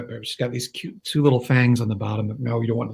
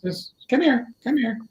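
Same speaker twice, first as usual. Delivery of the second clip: calling a dog; 10 semitones higher, 4 dB quieter than usual. Real, not acted.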